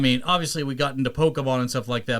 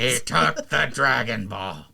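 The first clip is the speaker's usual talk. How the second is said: Nappa; slower than usual. Acted. Voicing the ghost of Nappa.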